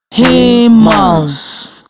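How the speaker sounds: HU-MANS split into two slow even beats; teacher modeling the syllables of Humans; clapped syllables. Shown as a demonstration. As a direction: say Humans beat by beat, one clap per syllable. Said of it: "Your turn".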